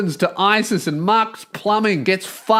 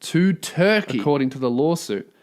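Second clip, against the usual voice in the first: lower, even reading of the report; news article read; 4 semitones lower than usual. A narrator's reading. Host reads the news story to listeners, dry lower read. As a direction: read the lawsuit report straight.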